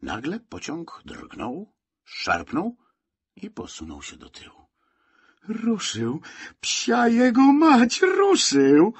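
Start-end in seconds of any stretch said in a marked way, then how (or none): 5.5-8.9 loud, very high cry of excitement; excited exclamation. A narrator's reading. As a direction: cry out, voice leaping way up. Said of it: "wykrzyknął Franek". (podekscytowany okrzyk)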